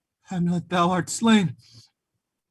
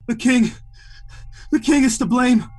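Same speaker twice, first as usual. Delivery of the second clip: urgent cry; 4 semitones higher, 3 dB louder than usual. Acted. Dying Laertes accuses the king.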